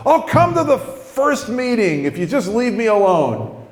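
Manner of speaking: high, exasperated burst; blurted outburst. Retold or imitated. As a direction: let it fly out before you can stop it. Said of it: "come flying out of my mouth".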